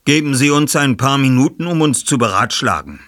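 höflich bestimmt. Acted. Ich-Figur zur Menge.

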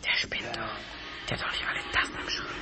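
hushed unvoiced whisper. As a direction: whisper so nobody hears. Hiding, whispering about the king.

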